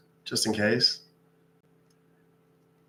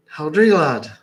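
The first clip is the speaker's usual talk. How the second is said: louder Irish leprechaun voice; teasing Irish brogue; 4 semitones higher, 7 dB louder than usual. Acted. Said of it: "I'm a leprechaun".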